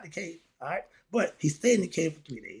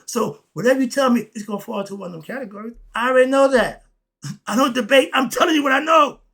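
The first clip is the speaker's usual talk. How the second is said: insistent shout; 7 semitones higher, 8 dB louder, faster than usual. Real, not acted. Preacher insisting he doesn't debate.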